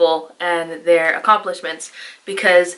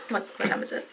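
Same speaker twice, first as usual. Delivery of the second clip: whispered question; soft whispered check of the time; 14 dB quieter, faster than usual. Real, not acted. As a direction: whisper it to yourself. Checking the clock quietly.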